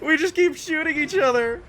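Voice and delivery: high, loud, through laughter; laughing. Real, not acted. Player and enemy keep trading shots, laughing. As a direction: squeal it out while cracking up.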